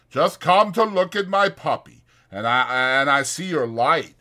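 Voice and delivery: put-on earnest big child explaining himself; Lenny explaining. A narrator's reading. Lenny explaining to Crooks why he came. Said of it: "he explained".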